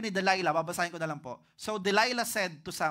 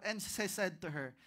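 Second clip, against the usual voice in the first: usual speaking voice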